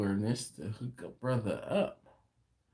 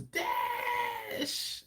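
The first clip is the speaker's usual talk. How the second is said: very high, stretched yell held back in volume; muted shout; 7 dB quieter than usual. Acted. Reading a viewer's greeting after eleven o'clock, muted high shout. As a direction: shout it long and high, but keep it down. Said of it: "I have to mute it".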